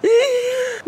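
high, wailing laugh-cry; real laugh. Real, not acted. Teller laughing at her fat diagnosis, high wailing laugh. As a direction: break into helpless crying laughter. Absurd punchline, bursts out laughing.

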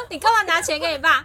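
loud, high disbelieving question; retelling a shocked loud question to a coworker; incredulous. Retold or imitated. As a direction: demand it loudly in disbelief.

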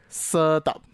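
色道 said again slowly and clearly; slow repeat demo. Shown as a demonstration. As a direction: repeat 色道 deliberately for the learner. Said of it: "再一次". (清楚地重复"色道")

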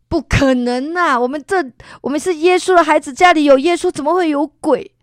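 insistent mother. Retold or imitated. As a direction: shut the fear down firmly.

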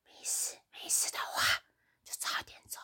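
breathy hushed secret; boss's conspiratorial whisper. Retold or imitated. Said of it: "他就走，走到我旁边".

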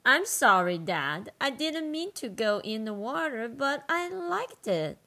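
put-on girl dragon voice. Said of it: "I need a girl dragon voice here".

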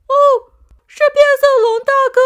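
very high, loud and fast joyful yell; happy shout. Acted. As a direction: shout with delight at spotting him. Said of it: "开心的大叫".

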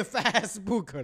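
FACEBOOK slowly as 'Fass-book'; mispronunciation demo. Retold or imitated. Host mocking the slip after adding book, FACEBOOK as 'Fassbook'. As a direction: stretch the wrong open 'a' in FACEBOOK. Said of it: "加一个book".

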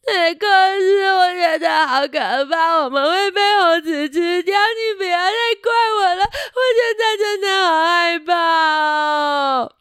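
put-on a terrified kid bawling on the floor; sobbing in fear. Buster wailing to Rex that he's scared. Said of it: "他在地板上一直哭，一直大叫".

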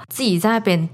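ordinary talk

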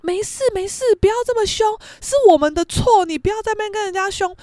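high, pleading peacemaker; mock pleading, pitched well up; imitated placating father. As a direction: hush a quarrel with anxious apology. Father placating, hushing his wife.

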